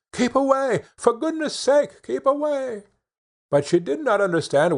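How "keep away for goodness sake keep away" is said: screamed warning. A narrator's reading. Sisters warning the third girl away, high alarmed cry. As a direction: shriek it up high to warn her off. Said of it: "the others screamed out".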